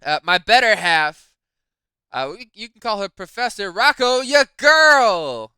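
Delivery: loud, rising showman announcement; announcer intro. Real, not acted. Husband introducing his wife as guest presenter, loud and excited. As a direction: hype up the guest like a ring announcer. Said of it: "ladies and gentlemen, welcome to their debut".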